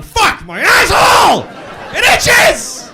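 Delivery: frantic yell. Retold or imitated. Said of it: "I still would have been going".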